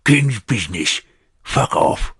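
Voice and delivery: talking around food; mouth full. A narrator's reading. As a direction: speak through a mouthful of meat. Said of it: "He spoke around his food".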